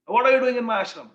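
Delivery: sharp, loud, high scolding yell; angry shout. Retold or imitated. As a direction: bark at the intruder from the veranda. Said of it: "and screamed at her".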